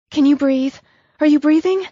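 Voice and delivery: panicked woman checking his breathing; frightened questions. Acted. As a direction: press him anxiously to breathe. She checks on injured man.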